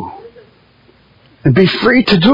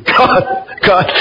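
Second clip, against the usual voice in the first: breathy real laughter round the words; laughing. Real, not acted. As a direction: laugh off the chicken joke. Laughing at own joke.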